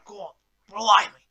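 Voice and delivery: bad Australian accent; exaggerated Aussie accent. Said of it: "that's the worst Australian accent ever".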